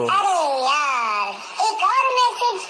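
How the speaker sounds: very high, laughing squeal through the words. Real, not acted. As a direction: squeak it out, cracking up. Amused by yet another message.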